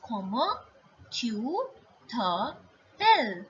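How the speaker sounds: broken into separate syllables; word by word. Shown as a demonstration. French teacher drilling the question, word by word. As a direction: model each piece alone, pausing between. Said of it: "I will tell you".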